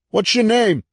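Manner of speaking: yelling over noise. A narrator's reading. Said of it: "yelled above the noise".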